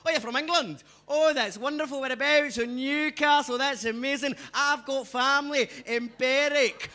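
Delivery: impersonated welcoming Edinburgh Scot; nice Edinburgh local. Retold or imitated. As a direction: gush politely in an Edinburgh Scottish accent. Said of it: "they're quite nice".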